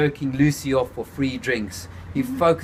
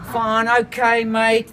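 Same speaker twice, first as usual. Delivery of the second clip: still cracking up; 10 semitones higher, 5 dB louder, slower than usual. A narrator's reading. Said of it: "still cracking up to himself".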